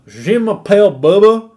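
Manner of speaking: loud, slow, hammed-up bad French; truck-nuts guy's French. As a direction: play a yokel attempting French. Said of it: "If he started going".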